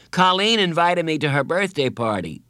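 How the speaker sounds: high, rushed burst of news; boy blurting it all out at once; blurted. A narrator's reading. Boy blurting his news to Carla. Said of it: "he blurted".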